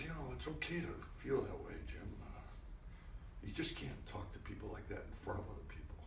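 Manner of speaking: soft, hushed private telling-off; quiet word. Retold or imitated. Director taking the actor aside in the trailer, soft quiet reproach. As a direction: calmly take him aside.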